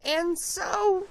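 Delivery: scolding Lorax. A narrator's reading. Lorax scolding the Onceler about the smog. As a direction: chide him like a fussy little creature.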